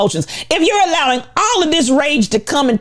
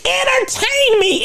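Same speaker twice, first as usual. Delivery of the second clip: mock demand; 12 semitones higher than usual. Mocking entitled crowd.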